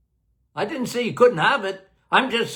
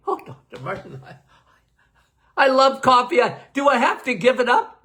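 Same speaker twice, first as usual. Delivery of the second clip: imitating a fretful patient, cracking toward a sob at the end; whiny patient; 4 semitones higher than usual. Patient pleading with Doctor Martin about coffee, whiny mock sob. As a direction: play the coffee lover begging to keep her cup.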